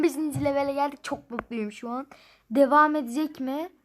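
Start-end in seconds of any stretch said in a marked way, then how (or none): none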